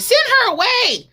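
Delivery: high, loud, exasperated dismissal; irritated disciples. Acted. Disciples brushing off the woman, loud and irritated. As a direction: shoo her off, fed up. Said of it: "was irritated".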